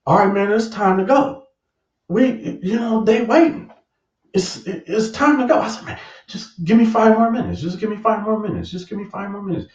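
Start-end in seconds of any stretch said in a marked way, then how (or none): 0.1-5.6 high, hurrying buddy voice; high-pitched friend urging him to leave; impatient friend. Retold or imitated. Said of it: "My partner was like". 5.9-9.7 high whiny begging that trails off; pleading. Retold or imitated. Begging friend for more time.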